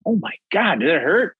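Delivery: high shocked gasp; stunned and worried. Retold or imitated. Rival asking about the staple, high shocked. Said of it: "he's like".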